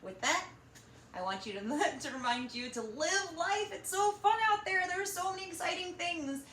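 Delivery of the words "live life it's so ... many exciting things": bursting with fun, higher; excited. Real, not acted. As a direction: sell the joy of life. Enthusing to kids.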